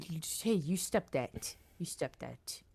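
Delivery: slow, soft fond telling-off; tender scolding. Acted. Uncle gently scolds niece.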